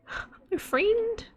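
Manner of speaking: mock Australian, "friend" leaping very high; imitated Australian accent. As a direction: try on an exaggerated Australian "friend". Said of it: "you trying to be me to say friend".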